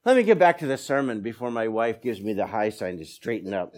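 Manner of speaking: ordinary talk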